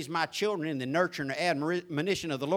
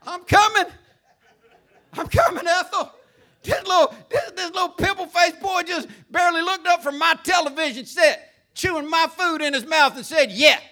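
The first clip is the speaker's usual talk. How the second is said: put-on high, loud cackling old lady voice; mock old lady; 10 semitones higher, 7 dB louder than usual. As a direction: ham up a squeaky granny with laughs. Mocking a doddering old lady.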